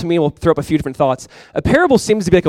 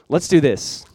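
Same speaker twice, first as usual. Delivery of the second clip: usual speaking voice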